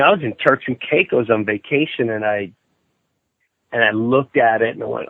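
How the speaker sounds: ordinary talk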